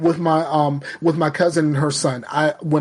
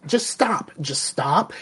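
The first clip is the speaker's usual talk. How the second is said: ordinary talk, nothing marked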